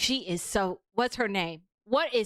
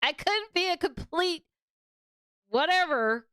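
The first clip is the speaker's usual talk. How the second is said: high, whiny mock victim voice, pitched way up; mock Karen whine; 8 semitones higher than usual. Streamer mocks the Karen blaming her seatmate, high mock whine. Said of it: "she's just like".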